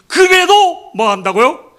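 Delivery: loud, high, drawn-out question; emphatic challenge. Real, not acted. Preacher asks congregation what still happens, loud challenge. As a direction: throw the question out forcefully, stretching it.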